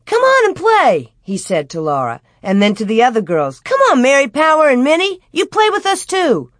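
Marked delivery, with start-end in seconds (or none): 0.1-1.1 high, eager playground call. A narrator's reading. Boy calling girl to join game. 3.6-6.5 high, quick playground call. A narrator's reading. Boy calling other girls to join.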